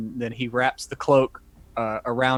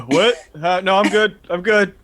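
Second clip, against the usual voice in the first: put-on groggy drunk voice; 7 semitones higher, 6 dB louder than usual. Drunk Xander waking up.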